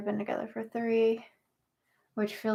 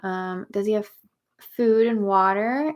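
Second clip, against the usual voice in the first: ordinary talk, nothing marked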